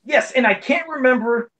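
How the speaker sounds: usual speaking voice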